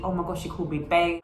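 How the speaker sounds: ordinary talk, nothing marked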